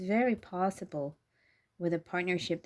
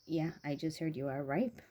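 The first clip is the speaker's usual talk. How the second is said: ordinary talk, nothing marked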